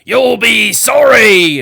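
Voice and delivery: slow, loud, high threatening shout; wizard shouting. A narrator's reading. Wizard on his ship threatening the barber, shouting. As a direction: hurl the threat back over the water. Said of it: "the wizard shouted back at Seville".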